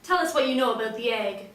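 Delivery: loud, stern detective demand; tough interrogator. Acted. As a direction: bark it like a hard-boiled cop. Slams her hand down.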